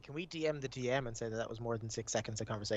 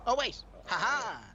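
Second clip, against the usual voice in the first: loud, high cry of delight; excited; 9 semitones higher, 9 dB louder than usual. Real, not acted. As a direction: shout it out in delight. Dice result turns out well.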